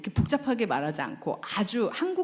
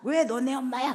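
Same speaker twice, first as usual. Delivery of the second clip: angry husband; 5 semitones higher than usual. Retold or imitated. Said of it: "화를 내고".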